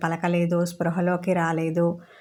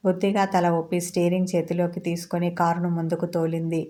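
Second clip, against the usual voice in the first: usual speaking voice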